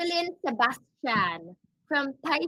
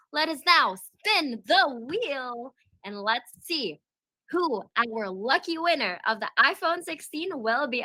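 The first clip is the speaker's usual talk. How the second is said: usual speaking voice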